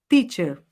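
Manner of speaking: teacher with a pure T, "tee"; correct teacher. Shown as a demonstration. Teacher modelled for students to repeat, with a pure T. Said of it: "Vamos repetir?".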